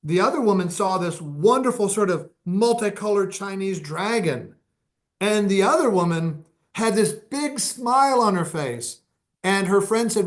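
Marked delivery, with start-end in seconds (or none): none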